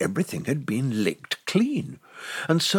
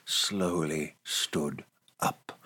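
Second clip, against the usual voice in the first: slow; 7 semitones lower, 5 dB quieter, much slower than usual. A narrator's reading. Bear slowly rising, read slowly.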